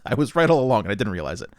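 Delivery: usual speaking voice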